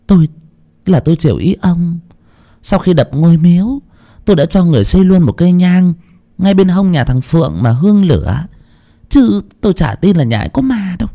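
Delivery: high-pitched wife standing her ground; sceptical wife. Acted. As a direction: argue back in a high woman's voice. (giọng bà vợ cao, phân bua, không tin có ma)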